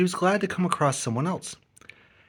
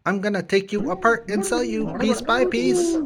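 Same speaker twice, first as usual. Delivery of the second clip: gloating threat; 8 semitones higher than usual. Acted. Tito threatens BB-8.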